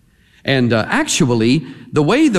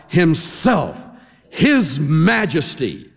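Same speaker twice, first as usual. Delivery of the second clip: grand royal title; 4 semitones higher, much slower than usual. Shown as a demonstration. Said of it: "It's like we would say".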